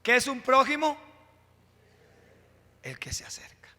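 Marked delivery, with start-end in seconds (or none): 2.8-3.7 whispered answer, dropping far down. Acted. Preacher answers his own question to the church in a whisper. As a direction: breathe the answer like a secret.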